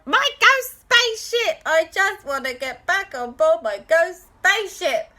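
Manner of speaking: high, loud pleading ghost voice; distraught ghost. Acted. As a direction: beg to get the ship back. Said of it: "was beside himself".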